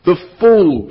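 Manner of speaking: high, loud scornful cry; cried out. A narrator's reading. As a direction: witch crying out in contempt. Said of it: "she cried".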